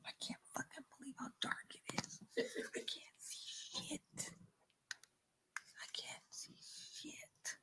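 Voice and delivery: real hushed, nervous whisper; scared whisper. Real, not acted. Ghost hunter alone in pitch dark, whispering scared. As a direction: whisper it, barely any voice. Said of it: "I'm whispering 'cause I'm scared".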